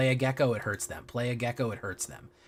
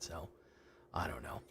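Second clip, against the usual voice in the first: whispered aside; 5 semitones lower, 16 dB quieter than usual. Real, not acted. Trailing off to himself.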